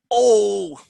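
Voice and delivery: loud, high, drawn-out "oh"; dramatic interjection. Shown as a demonstration. Teacher shows a dramatic interjection, exclaimed.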